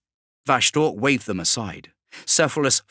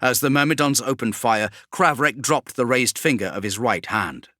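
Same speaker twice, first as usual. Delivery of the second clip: usual speaking voice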